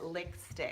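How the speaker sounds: "lick stick" with a k for the p of lipstick; "lick stick", imitating a little girl's word for lipstick; child's mispronunciation. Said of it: "My daughter Kiki used to call it".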